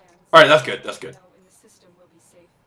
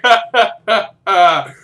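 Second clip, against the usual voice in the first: loud, higher-pitched fake laugh; mock laughter; 6 semitones higher, 7 dB louder, slower than usual. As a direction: imitate a crowd laughing at the downed guy. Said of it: "everybody's, like, looking at him, it's like".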